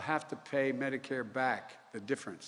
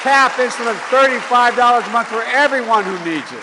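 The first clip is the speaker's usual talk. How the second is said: rally shout; 7 semitones higher, 13 dB louder than usual. Real, not acted. Rally climax applause line.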